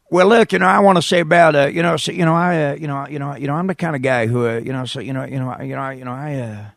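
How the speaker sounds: halting, stammering president losing his thread; George Bush impression. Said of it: "watching George Bush".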